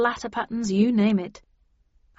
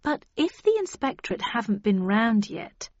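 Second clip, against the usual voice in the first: ordinary delivery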